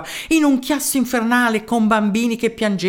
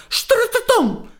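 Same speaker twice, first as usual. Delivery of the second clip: a long, slow, high cracking boom; slowed, high-pitched crash noise; crashing thunder; 14 semitones higher, much slower than usual. A narrator's reading. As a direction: sound out the sky splitting open. Narrated crash from the sky.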